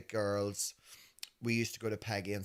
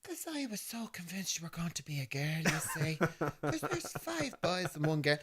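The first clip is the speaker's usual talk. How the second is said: higher, hushed imitation of mum; 9 semitones higher than usual. Mum confesses her disappointment, hushed high imitation. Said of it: "she was just carried on".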